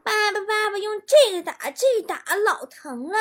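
put-on high-pitched little kid voice; high kiddie voice, eager to help; five-year-old child. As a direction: put on a small child egging dad on. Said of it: "五岁大的败家孩子进屋了".

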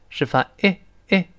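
ordinary talk

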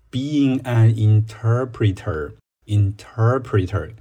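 slow "interpreter" with the stress on "-ter-", "inTERpreter"; careful pronunciation. Shown as a demonstration. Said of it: "注意一下它的写法以及读法". (放慢读"interpreter"，重音在"ter")

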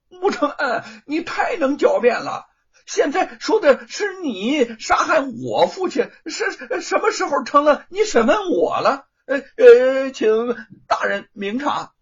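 loud, high, whining outrage; indignant protest. A narrator's reading. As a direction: sputter with offended rage. Luo Pang protests to the judge.

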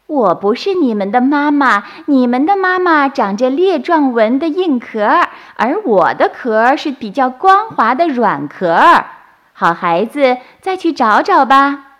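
high, loud motherly character voice; smiling softshell-turtle mother. A narrator's reading. Softshell mother sends baby turtles onward, smiling and loud. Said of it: "甲鱼妈妈笑着说".

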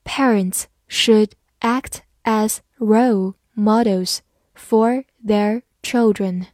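slow, one word at a time; slow reading. Shown as a demonstration. Teacher reads along with learners, slow reading. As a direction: space out every word for learners. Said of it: "好，慢慢来读".

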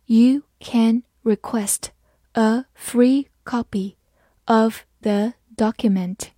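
slow, careful read-aloud; slow teacher demo. Shown as a demonstration. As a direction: read it slowly, phrase by phrase. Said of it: "好，慢慢来读".

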